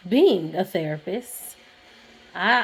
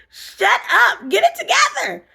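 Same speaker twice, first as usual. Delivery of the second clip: much higher, strained, crying out; breaking down; 13 semitones higher, 6 dB louder than usual. Retold or imitated. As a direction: voice cracking as she breaks in the theater. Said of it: "breaking in the movie theater like".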